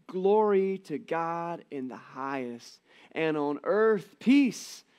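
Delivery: slow, reverent recital drawn out; Linus recitation. Retold or imitated. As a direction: savour each phrase like a solemn reading. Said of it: "And then Linus continues".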